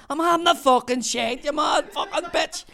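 high, loud retold shout; furious retort. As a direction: yell back through the door, outraged. Man yelling back from bathroom.

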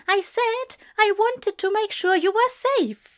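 high, flustered admission; embarrassed protest. A narrator's reading. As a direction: blurt it out in a high voice. Angel embarrassed, repeating himself indignantly.

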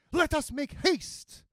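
very high-pitched mercenary nun; Murta's character voice. A narrator's reading. Mercenary nun urging escape on horseback, high voice. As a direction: urge them on in a high voice.

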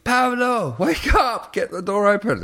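high, loud call to wake someone; urgent reenacted hollering; retold panicked shout. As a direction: yell through the hostel door for help. Acted banging on hostel door.

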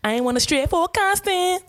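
put-on high, sing-song Bajan accent; singy Bajan accent. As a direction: exaggerate a lilting Bajan accent. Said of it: "very singy".

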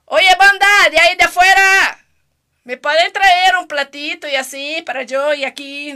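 loud call to the crew off camera. Real, not acted. Calling loudly to the crew outside for a plate. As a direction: raise your voice to reach the people outside.

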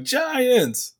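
high-pitched mock panic cry; freaking out. Panicking ghosts raise the alarm about giants, freaking out. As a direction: call out in hammed-up alarm. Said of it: "they're freaking out".

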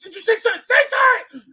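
high, loud panicked shouting; a friend screaming at his buddy to apologize; freaking-out yell. Retold or imitated. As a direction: yell it like he's losing it. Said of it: "he's freaking".

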